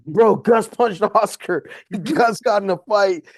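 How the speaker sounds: gleefully spilling the gossip between laughs; excited laughing report. Retold or imitated. Jesse telling everyone backstage about the punch, laughing and excited.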